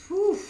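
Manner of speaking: high, loud exhaled whew. Real, not acted. Reader blowing out an amazed whew at the spread. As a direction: blow out a big amazed whew. Reacting to powerful card spread.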